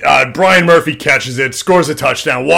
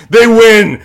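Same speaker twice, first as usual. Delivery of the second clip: higher and louder, triumphant; excited; 9 semitones higher, 7 dB louder than usual. Real, not acted. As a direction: announce the win with a burst. Climax of retold game.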